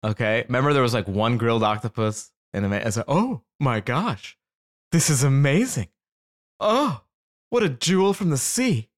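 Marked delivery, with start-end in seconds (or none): 3.1-8.9 hammed-up gushing diner; mock awe. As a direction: gush over it like a delighted foodie. Acts out awed octopus diner.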